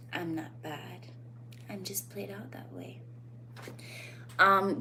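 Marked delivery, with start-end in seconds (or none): 0.1-3.0 sultry femme fatale. Shown as a demonstration. Demonstrating the Jessica Rabbit line to viewers, soft and sultry. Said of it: "Jessica Rabbit".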